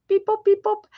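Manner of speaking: high-pitched robot beep-boops; little high beeps and boops; playful beeping. Pretend. Owner fills a pause, playful high beeping.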